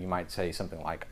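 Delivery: normal talking voice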